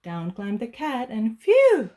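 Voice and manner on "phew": high, loud sigh of relief; much higher, louder dog phew; relieved phew. Acted. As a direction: blow out relief. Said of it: "said the dog".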